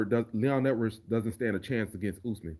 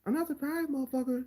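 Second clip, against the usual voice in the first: much higher, squeaky take with no swag; high mocking Pena; 3 dB quieter than usual. Contrasting Pena's take with Nate's, in a much higher voice. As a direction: squeak it out with no attitude. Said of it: "Didn't come out right".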